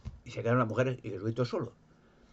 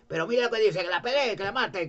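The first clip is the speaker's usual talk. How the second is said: mock outrage; 9 semitones higher, 5 dB louder than usual. Pharisee mockingly addresses the crowd.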